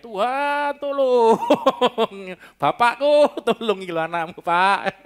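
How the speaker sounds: loud, high, drawn-out cry for help. Acted. Preacher shows raw prayer to Father God, crying out. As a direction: holler it out to Father God, stretching the vowels. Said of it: "mau teriak ya teriak".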